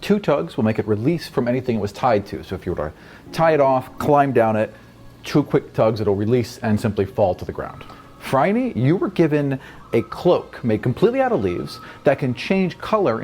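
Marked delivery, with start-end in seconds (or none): none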